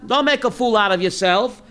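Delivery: ordinary delivery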